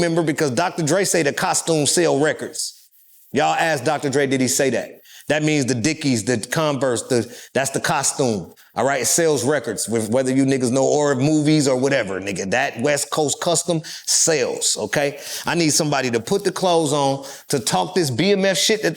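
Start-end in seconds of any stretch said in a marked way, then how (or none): none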